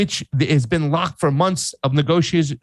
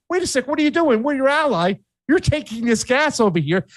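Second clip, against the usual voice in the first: mock-indignant, higher voice; indignant surprise; 8 semitones higher than usual. Spain to Italy, hammed-up higher-pitched protest. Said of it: "and goes".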